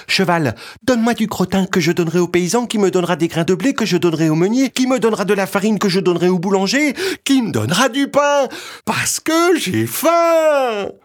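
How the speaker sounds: put-on high, fast child's breathless chain; small boy voice. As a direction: rattle off the list like a little boy. Petit bonhomme asks the horse.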